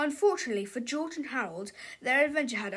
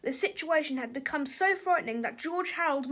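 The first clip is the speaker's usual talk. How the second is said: usual speaking voice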